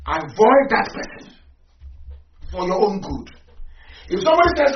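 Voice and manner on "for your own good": ordinary talk, nothing marked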